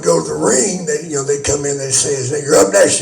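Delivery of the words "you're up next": calling out. Retold or imitated. Retelling an official calling the wrestler.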